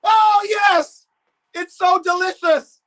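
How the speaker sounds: very high, loud goal celebration; real excited shouting. Real, not acted. Streamer cheering on Cuckoo as he shoots, excited shouting. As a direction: scream with delight, voice shooting way up. Live reaction to a goal chance.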